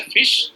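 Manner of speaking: "fish" with a full sh ending; Bengali-style "fish", ending in palatal sh. Shown as a demonstration. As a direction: close "fish" on a clear hushing sh. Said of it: "Bengali will have".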